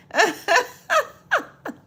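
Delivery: loud high burst of laughter; big laugh. Real, not acted. Laughing at Lawrence's comment.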